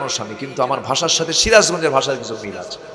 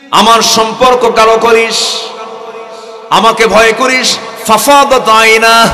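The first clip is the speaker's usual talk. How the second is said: fiery preaching; 7 semitones higher, 9 dB louder than usual. Acted. Preacher urging the congregation, impassioned shouting. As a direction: thunder out God's promise.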